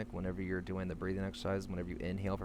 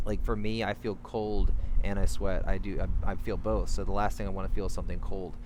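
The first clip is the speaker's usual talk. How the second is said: emphatic loud; 8 dB louder than usual. Real, not acted. Emphatic about feeling cold and sweating.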